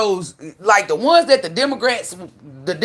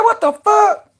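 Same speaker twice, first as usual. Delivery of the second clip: very high, loud what the fuck; shocked yelp at the machine; retold outraged disbelief; 14 semitones higher, 4 dB louder, slower than usual. Cheated by the vending robot, a very high, loud outburst. Machine keeps her dollar.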